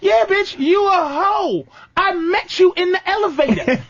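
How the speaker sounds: high, brash teasing put-down; mock blunt retort. Answering the swooning woman, pitched high and mocking. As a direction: snap back high and mocking. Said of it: "reply to the mock woman".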